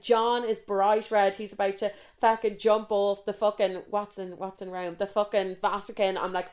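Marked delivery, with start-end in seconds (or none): none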